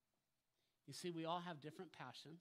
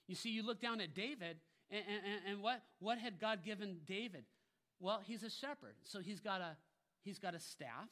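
loud, raised sermon voice; full-force preaching; 6 semitones higher, 9 dB louder than usual. Acted. Preacher asking what God gave David, full-force preaching. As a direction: preach it high and insistent.

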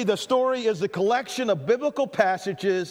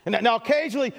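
usual speaking voice

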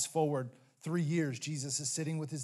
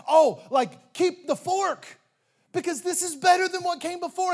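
delighted banquet master; 12 semitones higher, 10 dB louder than usual. Acted. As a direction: burst out in delight over the better wine.

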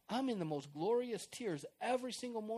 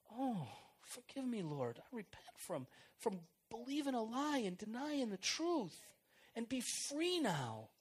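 a lightly voiced penitent prayer; humble repentance; 5 semitones higher than usual.

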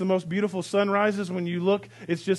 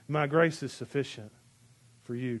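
soft, low and intimate; hushed voice of God; 5 semitones lower, 6 dB quieter than usual. Acted. Pastor voicing Jesus speaking grace to Pol Pot, hushed. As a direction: say it gently, almost to yourself. God's words to Saddam Hussein.

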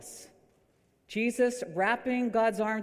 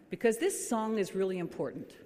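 ordinary talk

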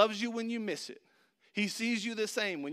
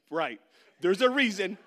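louder, pointed coach reply; coach's hint; 7 dB louder than usual. Retold or imitated. Coach telling the benchwarmer why, pointed. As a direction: lean on it as a hint. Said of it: "He's like".

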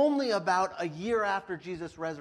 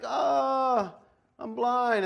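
whiny Saul; 6 semitones higher, 5 dB louder, much slower than usual. Retold or imitated. Saul realizing blindness.

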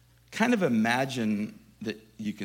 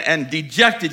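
ordinary delivery

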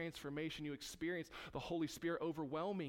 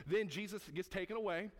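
ordinary talk, nothing marked

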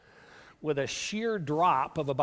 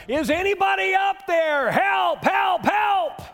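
high, loud shout from the cliff; desperate yell for help; 12 semitones higher, 6 dB louder, slower than usual. Acted. Man dangling from a branch yells for rescue, loud high shout. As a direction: holler at the top of your lungs. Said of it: "hollering at the top of his lungs".

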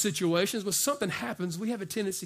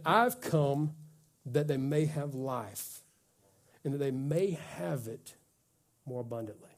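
solemn scripture quote; 5 semitones lower, 4 dB quieter than usual. Acted. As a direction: let each phrase settle, with long pauses. Preacher reads Jesus' words solemnly.

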